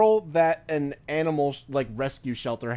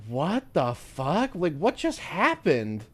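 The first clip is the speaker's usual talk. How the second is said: slow, drawn-out mock bewilderment; a hint of dazed shock; stunned disbelief; 4 semitones higher, much slower than usual. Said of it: "And it's like".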